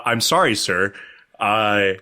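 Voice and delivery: careful, deferential sorry; meekly polite retold apology; uncool, polite apology. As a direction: apologize formally to someone important. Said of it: "I wasn't that cool".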